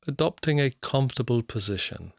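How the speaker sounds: ordinary talk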